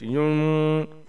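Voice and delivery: युन with a long humming न; nasal ghunna. Shown as a demonstration. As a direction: let the न of युन ring on in the nose. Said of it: "नाक में आवाज़ को छुपा के".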